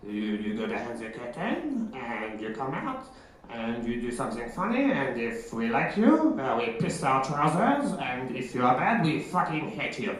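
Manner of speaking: put-on quiet, teasing French clown-master accent. French clown teacher sending a student behind the curtain, in a soft mocking French accent. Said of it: "this is where the accent comes in".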